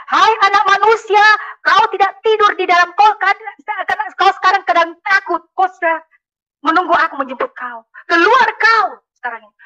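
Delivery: high, loud prophetic cry; retold shout. Prophet summons the sleeping father out, retold shout. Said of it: "Tiba-tiba langsung dia berteriak".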